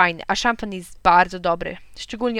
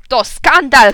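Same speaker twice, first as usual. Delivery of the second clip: higher, much louder outraged cry; angry shout; 4 semitones higher, 12 dB louder, much slower than usual. A narrator's reading. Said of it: "krzyknął".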